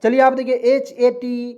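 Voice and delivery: ordinary talk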